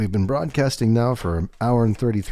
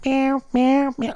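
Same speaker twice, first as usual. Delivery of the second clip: high mewing cat imitation, far above own voice; cat meowing; slower than usual. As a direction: mew like an insistent cat wanting attention. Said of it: "he was going".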